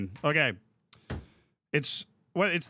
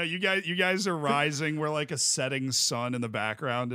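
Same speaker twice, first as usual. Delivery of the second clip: usual speaking voice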